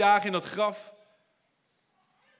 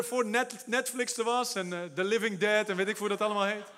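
usual speaking voice

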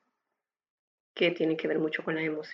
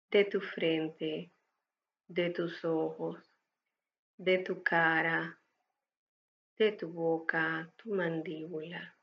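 calm breathing guide, unhurried and gentle; guided meditation; 5 dB quieter than usual. Acted. (voz de meditación guiada)

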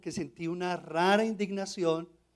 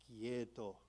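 calm hush; 6 semitones lower, 15 dB quieter than usual. Retold or imitated. The Lord's calm inner voice.

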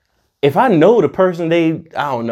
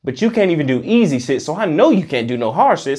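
ordinary talk, nothing marked

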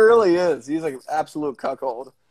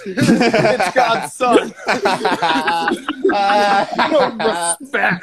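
laughing punchline; 4 semitones higher, 5 dB louder, much slower than usual. Retold or imitated. Dangerfield bit punchline.

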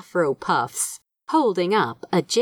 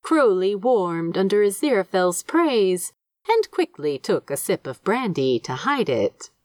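ordinary delivery

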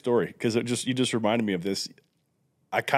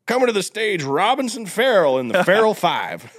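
stage announcer; 8 semitones higher, 3 dB louder than usual. Pretend. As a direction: introduce the act like a stage emcee. Mock stage introduction.

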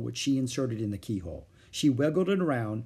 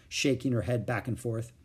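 plain reading voice

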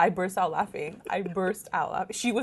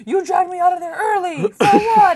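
much higher, louder mocking dad, laughing; mock angry dad; 10 semitones higher, 10 dB louder than usual. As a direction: ham up the dad's outburst. Dad's outburst retold.

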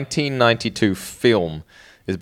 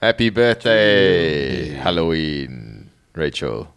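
spooky voice; 6 semitones lower, slower than usual. Pretend. Spooky birthday greeting.

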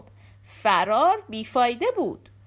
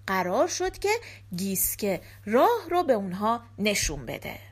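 ordinary delivery, nothing marked

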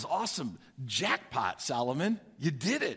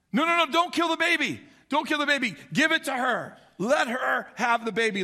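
higher, louder frantic begging; desperate plea; 6 semitones higher, 4 dB louder than usual. Retold or imitated. True mother begging Solomon to spare her child, frantic. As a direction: beg the king to spare the baby.

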